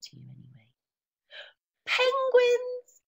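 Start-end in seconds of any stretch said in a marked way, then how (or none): 1.9-3.0 high, loud delighted cry; thrilled page-turn reveal; excited reveal. Acted. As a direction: announce it like a wonderful surprise.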